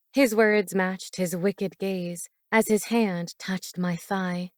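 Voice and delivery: ordinary delivery, nothing marked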